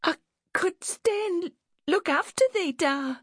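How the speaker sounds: put-on very high, halting child voice; pleading little girl. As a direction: beg Da to let you stay.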